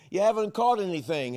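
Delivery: high, loud knowing call; calling across distance. Acted. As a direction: holler a teasing question to the boat. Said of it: "he had to lift up his voice".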